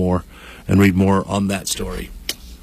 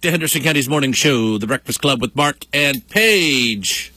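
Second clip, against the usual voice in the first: big morning-radio welcome, pitched high; radio show opener; 7 semitones higher than usual. Acted. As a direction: sing out the show's opening like a radio host.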